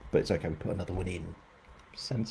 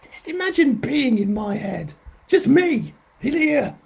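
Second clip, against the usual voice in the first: squeaky head voice; 5 dB louder than usual. Pretend. Mock voice of her busy head.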